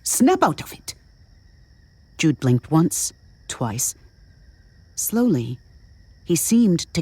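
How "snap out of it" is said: high, sharp command. A narrator's reading. Woman orders a dazed man to wake, sharply. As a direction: jolt him with a pitched-up order. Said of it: "she said sharply".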